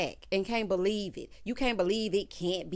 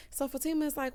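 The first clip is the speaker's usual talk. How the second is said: ordinary talk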